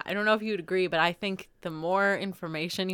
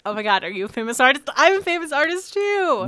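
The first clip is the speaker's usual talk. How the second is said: gushing, faster and louder, jumping much higher at the end; mock starstruck artist; 7 semitones higher, 6 dB louder than usual. Mocking Berlin artists meeting each other, in a gushing starstruck voice. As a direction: ham up an artist fawning over another.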